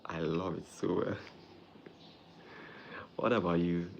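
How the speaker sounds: usual speaking voice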